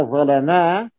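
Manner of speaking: "ظلما" as ẓalamaa; all a-vowels on "ظلما"; active vowelling. Shown as a demonstration. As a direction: read "ظلما" as active ẓalamā with a long final alif. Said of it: "فيكون الفعل مبنيا للمعلوم".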